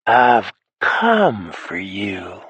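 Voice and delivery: a slow, menacing villain's whisper; the stranger on the phone threatening the hero; slow, drawn-out menace; ugly low whisper. Acted. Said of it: "a low-down and ugly whisper".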